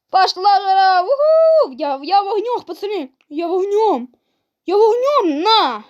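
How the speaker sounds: loud, high battle cries; pumped up, yelling at the screen; hyped shouting. Real, not acted. Gamer on fire mid-fight, yelling excitedly. On a winning streak.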